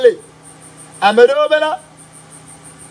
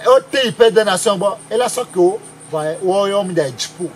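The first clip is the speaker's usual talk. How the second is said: usual speaking voice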